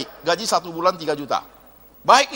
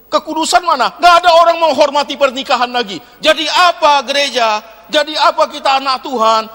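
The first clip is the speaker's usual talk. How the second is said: loud, raised preacher's rebuke; fiery preaching; 6 semitones higher, 7 dB louder than usual. Acted. Preacher scolding the church about sex before marriage, loud and fiery. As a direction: thunder it at the congregation.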